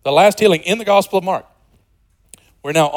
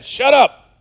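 mock crowd shout; 10 semitones higher, 6 dB louder than usual. Crowd voiced.

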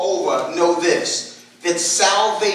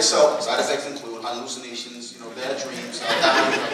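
fast disclaimer voice; 7 semitones lower, 4 dB quieter than usual. Retold or imitated. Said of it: "sort of real quickly while you're all distracted".

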